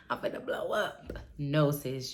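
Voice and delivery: ordinary talk, nothing marked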